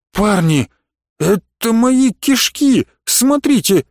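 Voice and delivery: высокий, изумлённый возглас раненого; изумись, подняв голос высоко; искреннее изумление. A narrator's reading. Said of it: "с искренним изумлением произнёс один из шакалов". (с искренним изумлением, высоким голосом)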